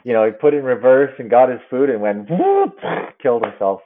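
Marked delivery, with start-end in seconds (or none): 2.3-2.8 sudden very high whoop; a quick, playful high yelp. Retold or imitated. Imitated sound of car move.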